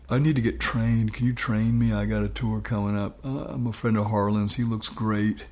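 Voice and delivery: even-toned Gainesville accent impression; acted musician's Gainesville accent; [name]. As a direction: ask plainly in a put-on Gainesville accent. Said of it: "best I can do is my Gainesville accent".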